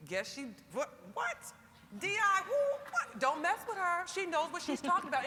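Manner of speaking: a hammed-up chorus of people backing down; voicing impressed onlookers. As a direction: play the stunned doubters who back right off. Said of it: "because they'll say".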